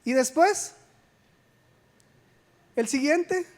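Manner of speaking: ordinary delivery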